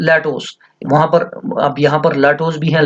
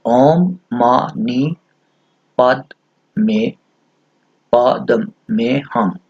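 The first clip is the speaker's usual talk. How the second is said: each syllable drawn out slowly; chanting the Sanskrit mantra slowly; slow mantra recitation; much slower than usual. Shown as a demonstration. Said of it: "छह syllable हैं इस मंत्र के".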